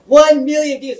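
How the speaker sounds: loud, high announcement of a huge number; excited shout. Retold or imitated. Silent driver suddenly shouting the view count, excited. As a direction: blurt it out, thrilled.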